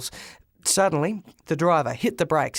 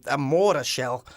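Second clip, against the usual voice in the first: usual speaking voice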